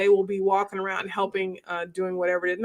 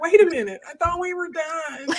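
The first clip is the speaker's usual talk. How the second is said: high, exasperated "wait a minute"; mock exasperated surprise; 8 semitones higher than usual. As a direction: act caught off guard by yet another question. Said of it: "It's like".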